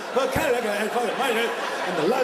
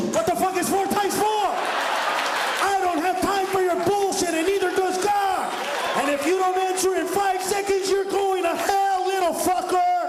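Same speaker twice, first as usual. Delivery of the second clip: screaming nun; 7 semitones higher than usual. Acted. Strict nun screaming at a student who can't answer the math question. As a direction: bellow like a nun who's lost all patience.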